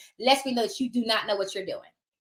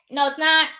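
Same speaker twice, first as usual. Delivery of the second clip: ordinary talk, nothing marked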